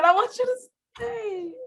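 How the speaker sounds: very high, drawn lovesick plea; clingy pining. Acted. Asking partner to stay, high-pitched clingy whine. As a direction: beg sweetly right at the top of the voice. Acts out lasting lovesick feeling.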